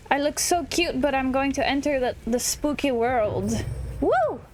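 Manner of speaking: ordinary talk, nothing marked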